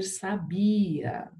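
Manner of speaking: "sabia" with a long BI and weak final A; stress on BI. Shown as a demonstration. Teacher reading the word without accent, stressed on BI. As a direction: say "sabia" as saBIa, falling after BI. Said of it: "sem acento eu teria que escrever".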